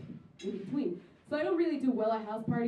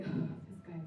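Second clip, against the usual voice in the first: exasperated whisper; 7 semitones lower, 7 dB quieter than usual. Retold or imitated. To herself about the drunk creep, a weary whisper. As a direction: whisper it after a sigh, fed up.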